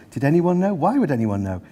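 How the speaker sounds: ordinary delivery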